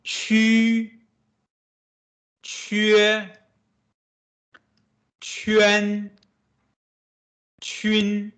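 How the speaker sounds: qu, que, quan, chun read as clear model syllables; teacher modeling Mandarin syllables; Mandarin syllables demo. Shown as a demonstration. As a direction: model each syllable for the class.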